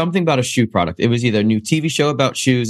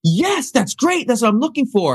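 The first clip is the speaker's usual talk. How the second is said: mock casting director; 13 semitones higher than usual. Casting director approving, mocked.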